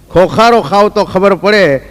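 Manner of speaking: ખોખારો drawn out slowly and weighted; slow emphatic recital. Acted. Comedian to audience, repeating the punchline slowly.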